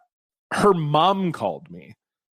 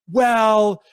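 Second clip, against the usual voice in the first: a higher, pleading mom voice, half-hearted; lightly voiced worried mother, pitched up; imitating her mom; 4 semitones higher than usual. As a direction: mom defending her daughter to the ex, mock plaintive voice. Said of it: "her mom was like".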